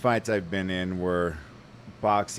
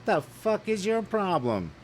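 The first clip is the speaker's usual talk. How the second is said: retold shout; 12 semitones higher than usual. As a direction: bark it at him in disbelief. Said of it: "and I'm like".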